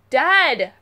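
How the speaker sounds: loud call to her father; yelled. Acted. Girl yelling at her joking father, loud. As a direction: shout Dad across the house. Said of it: "I yelled".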